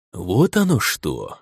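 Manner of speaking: slow, drawn-out realization; knowing drawl. Acted. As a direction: stretch it out as it dawns on you. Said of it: "догадливо протянул Хайртур".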